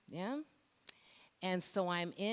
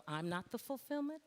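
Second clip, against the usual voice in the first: mock mistaken belief; 6 semitones higher than usual. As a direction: echo the false belief back, pitched up.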